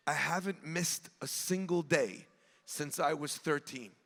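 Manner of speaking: low, soft, solemn retelling; quiet confession. Retold or imitated. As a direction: lightly voice an old man's hushed devotion. Retold confession of lifelong devotion.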